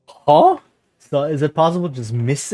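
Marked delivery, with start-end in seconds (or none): 0.1-0.6 higher and louder, baffled; startled huh. Real, not acted. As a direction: yelp of confusion. Confused by outcome.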